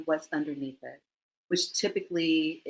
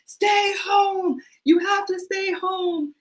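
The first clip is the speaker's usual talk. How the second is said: high, loud imitated shout, held on one note; mock yelling; 8 dB louder, slower than usual. Scared adult child ordering parents indoors, high acted yell. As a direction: holler it like pleading with stubborn parents. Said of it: "And so we yell at them".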